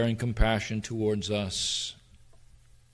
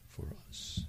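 whispered; 17 dB quieter than usual. Real, not acted. Emotional sermon on God's care.

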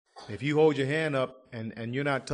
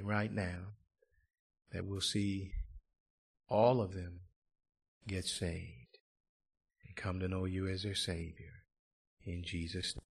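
closing prayer; 4 semitones lower than usual. Acted. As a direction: pray over them gently.

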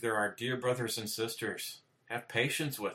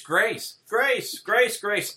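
high, loud mocking chant of grace; mocking false preachers; 11 semitones higher, 9 dB louder, much slower than usual. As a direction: parody their sing-song grace grace grace. Mocking what false churches preach.

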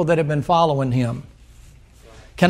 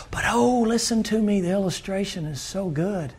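softer, drawn-in preacher's aside; softer confiding sermon tone; hushed aside; 2 semitones lower, 6 dB quieter than usual. Acted. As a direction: pull the congregation close and drop the voice. Preacher drops to intimate aside.

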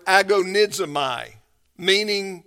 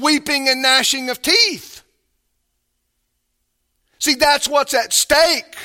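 louder, higher pulpit preaching; full-force preaching; 7 semitones higher, 6 dB louder than usual. Acted. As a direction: preach it with urgency and weight.

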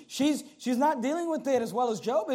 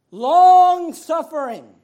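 "long-suffering" loud and high, with "long" held out; drawn-out emphasis; 5 semitones higher, 14 dB louder, much slower than usual. Real, not acted. Preacher defining real patience, "long-suffering" stretched and proclaimed loudly. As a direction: boom out "long-suffering", stretching the first syllable.

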